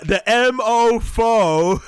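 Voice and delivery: slow, high, loud mock of her; mocking mummy voice. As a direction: parrot her prim mum voice. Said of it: "mocking her mummy voice".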